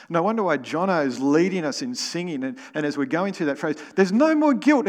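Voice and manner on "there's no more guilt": much higher, louder mock call to the church; imitating a song leader. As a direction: belt it out like the worship leader. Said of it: "Jono sort of goes off beat and goes".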